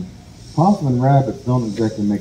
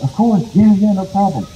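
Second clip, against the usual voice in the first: breezy assurance; 7 semitones higher than usual. Acted. Rabbit glibly agrees.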